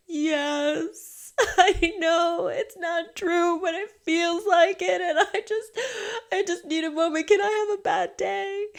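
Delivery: high, teary voice breaking into laughter; laughing through tears. Retold or imitated. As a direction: laugh and cry at once, pleading. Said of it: "I was crying".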